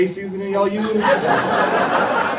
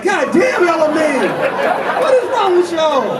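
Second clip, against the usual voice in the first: high, outraged whine, a bit slower at the end; high-pitched disbelief; 11 semitones higher, 3 dB louder, slower than usual. Retold or imitated. Comic telling the crowd how hurt he was, in a high whine. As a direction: squeak it out high and wounded. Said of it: "I was like".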